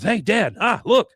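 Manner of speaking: high, loud alarm to Dad; urgent kid call. Retold or imitated. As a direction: wake Dad up fast. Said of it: "Bobby sees him and he goes".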